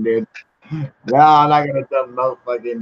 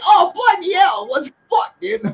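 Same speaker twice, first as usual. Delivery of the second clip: mock British exclamation. Canada mocked with British exclamation.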